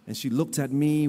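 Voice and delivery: ordinary talk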